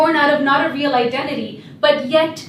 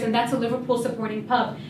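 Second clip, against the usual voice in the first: ordinary delivery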